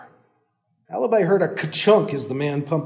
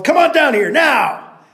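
loud, much higher shout; 10 semitones higher, 8 dB louder, slower than usual. Acted. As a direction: bark the order. Landowner shouting order.

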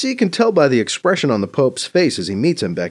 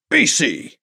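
higher, scandalized exclamation; exclaimed; 7 semitones higher, 3 dB louder than usual. Acted. Mbeke shocked by BC's remark on scripture, exclaiming. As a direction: cry out the name in reproof. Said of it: "Mbeke exclaims".

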